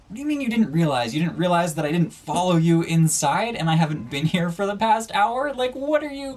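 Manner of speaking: high, loud, fast disbelieving rant; incredulous and fed up, voice shooting up; exasperated outburst. Retold or imitated. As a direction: let the disbelief explode as you quote yourself. Angry retort at the friend.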